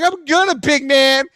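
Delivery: high-pitched argumentative jeer; retold taunting. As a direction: re-enact goading the neighbor. Continuing the acted taunting match.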